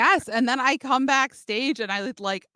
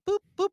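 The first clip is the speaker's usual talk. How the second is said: high chirpy beeps mimicking a scanner; playful boop sounds; 11 semitones higher than usual. Flashing her pass at the crowd with high boops.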